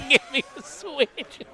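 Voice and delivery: high, slow granny through a laugh; old Southern grandma. Acted. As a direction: play a scolding grandma, half laughing. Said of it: "old Southern grandma".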